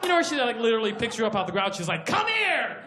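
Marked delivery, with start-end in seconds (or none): 2.1-2.8 high, loud grabbing yell; grabbing shout. Retold or imitated. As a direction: yell it while grabbing someone. She grabs you.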